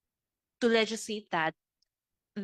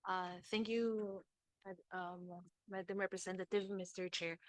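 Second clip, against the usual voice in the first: soft, barely audible formal thanks; too quiet; 12 dB quieter than usual. Real, not acted. DOJ representative thanking the chair at a hearing, too quiet. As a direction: speak too softly for the room to hear. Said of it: "can you please speak louder".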